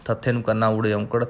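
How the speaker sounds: ordinary talk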